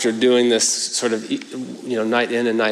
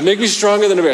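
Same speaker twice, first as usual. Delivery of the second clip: put-on insistent little boy; 9 semitones higher, 5 dB louder, faster than usual. Son insisting on his prayer.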